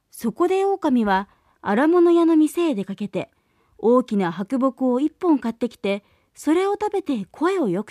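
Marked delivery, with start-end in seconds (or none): none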